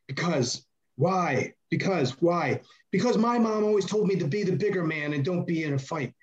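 mock childish explanation; whiny excuse. Imitating Chad explaining.

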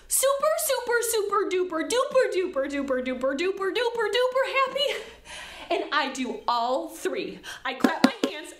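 ordinary talk

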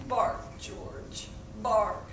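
low, soft worn-out plea; exhausted almost whispered bark please; tired vet. Acted. Tired vet begging George softly to bark. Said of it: "The vet is very tired".

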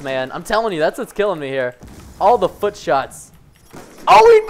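normal talking voice

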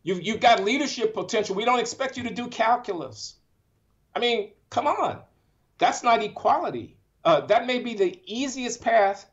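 emotional. Real, not acted. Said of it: "because I am emotional about this".

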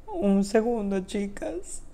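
voz quebrada y baja; crying. Real, not acted. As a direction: pide una pausa entre lágrimas. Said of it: "perdón que estoy llorando".

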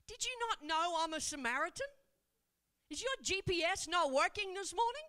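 sassy retort. Acted. Woman retorts to Jesus, sassy.

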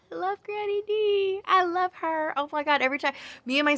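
high, excited squeal of love; gushing fan. Real, not acted. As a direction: squeal like a fan over a favourite reader. Gushing about a favourite narrator.

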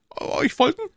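very high frightened question; stammering. Acted. Prisoner ordered out at night, high frightened stammer. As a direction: stammer it in fear. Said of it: "stammelte Bonacieux".

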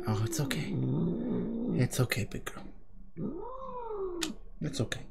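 quiet reassuring murmur; gently calming a scared pet; soft bedside voice. Real, not acted. Soothing pet softly.

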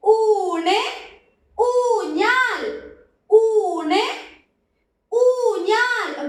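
ഊണ് and ഊഞ്ഞാൽ high and loud, ഊഞ്ഞാൽ stretched slowly; model words called out. Shown as a demonstration. Teacher to class, reviewing the words, loud and clear. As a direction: call out each word clearly for the class. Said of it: "ഏതൊക്കെയാണ്?".

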